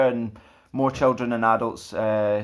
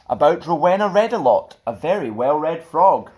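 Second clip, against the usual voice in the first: lively, sing-song storybook reading; children's story narration; 6 semitones higher, 4 dB louder than usual. A narrator's reading. Stuart reading a picture book to children, lively. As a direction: read it like bedtime storytime for kids.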